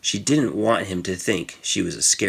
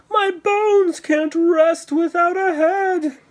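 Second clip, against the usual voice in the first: high, very slow wailing ghoul voice; moaning skeleton; 4 dB louder, slower than usual. Acted. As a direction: wail like a headless skeleton searching. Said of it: "the skeleton said".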